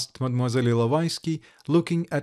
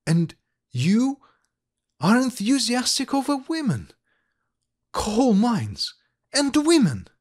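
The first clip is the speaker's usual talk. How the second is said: higher, slowed, dramatic pleading; heated; 6 semitones higher than usual. Acted. Ilovaisky pleading with smiling Likharev, heated and higher. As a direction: paint the bleak steppe with heat. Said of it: "ashamed of her heat".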